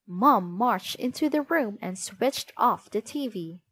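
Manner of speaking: usual speaking voice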